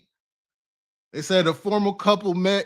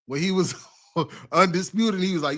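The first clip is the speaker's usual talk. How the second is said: ordinary delivery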